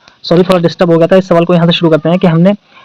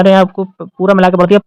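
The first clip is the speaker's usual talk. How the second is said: usual speaking voice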